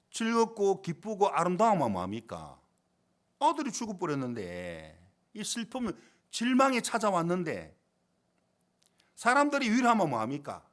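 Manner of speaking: emphatic pulpit appeal, phrases stretched; impassioned preaching. Real, not acted. Preacher telling the congregation only Jesus can comfort, impassioned preaching. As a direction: press each rhetorical question on the listeners.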